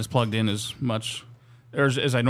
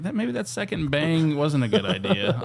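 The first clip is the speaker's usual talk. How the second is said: slow realization; 6 semitones higher than usual. Retold or imitated. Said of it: "And I was like".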